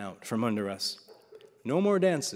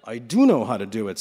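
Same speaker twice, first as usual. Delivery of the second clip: through his teeth; 9 dB louder than usual. Acted. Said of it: "Slava said through his teeth".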